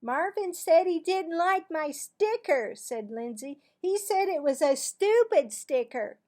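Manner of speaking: put-on high, loud little girl telling on her brother; high, loud kid complaining to mother; whiny tattling. A narrator's reading. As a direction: tattle to mom.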